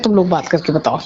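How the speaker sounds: ordinary delivery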